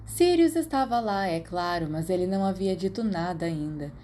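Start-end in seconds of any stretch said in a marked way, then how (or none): none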